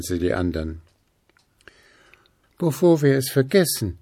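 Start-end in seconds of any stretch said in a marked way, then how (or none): 2.6-4.0 higher voice; just remembered; Schnuffi. A narrator's reading. Schnuffi invites the ghosts, higher. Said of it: "fiel Schnuffi noch ein".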